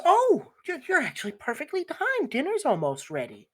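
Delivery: high-pitched motherly "oh!"; surprised mother. Acted. Mother seeing her child stroll back in, surprised. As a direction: greet them in pleased, high surprise. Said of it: "Your mother kind of very surprisingly sees you".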